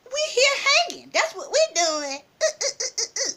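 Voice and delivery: imitating a sassy girl, high-pitched; high mocking back talk, ending in staccato uhs; smart-mouthing. Rival girl answers back, imitated smart-mouthing. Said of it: "She just went smart mouthing".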